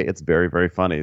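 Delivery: ordinary talk, nothing marked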